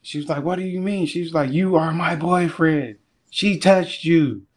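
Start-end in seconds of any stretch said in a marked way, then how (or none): 0.4-1.1 high-pitched indignant question; mock outraged, pitch raised; pissed girlfriend. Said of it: "she basically was pissed". 1.5-4.4 indignant retold rant, pitched up; pissed girlfriend. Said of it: "she basically was pissed".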